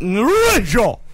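dragon shout. Acted. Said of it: "give me a, s-, a dragon noise".

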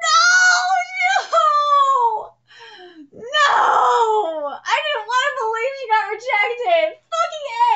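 high, loud wailing no; anguished shout. Real, not acted. Fan shouting in anguish over the rejection. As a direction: cry out in real fan anguish.

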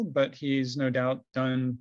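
ordinary delivery

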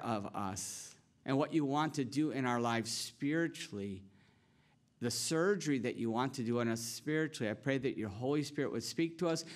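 hushed, earnest pastoral prayer. Acted. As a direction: pray quietly over the congregation. Pastor leads closing prayer.

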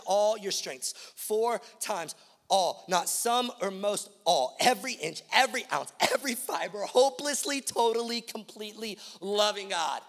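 "all" punched loud, building to a high, insistent list; emphatic, loud preaching on "all"; stress on all. Shown as a demonstration. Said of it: "love isn't even the most repeated word".

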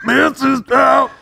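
high laughing shouted rocker. Pretend. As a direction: ham up the rock singer. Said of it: "my spot-on Nickelback impression".

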